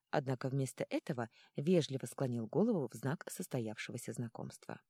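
plain reading voice

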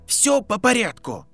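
sharp. Acted. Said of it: "резко сказал Гарри". (резко)

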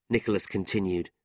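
ordinary delivery, nothing marked

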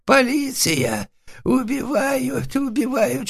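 very high, drawn-out bleat for help; goat-like bleating. A narrator's reading. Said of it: "заблеял козлом". (истошно блеять козлом)

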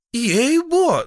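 very high, loud oath; protesting peasant woman. A narrator's reading. Patient swearing to the stern feldsher, protesting. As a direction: yelp your innocence.

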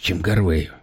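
ordinary delivery

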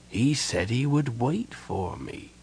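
soft, slow voice of a dying man; growing fainter. A narrator's reading. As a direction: let the strength drain away. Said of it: "his voice beginning strong but growing fainter".

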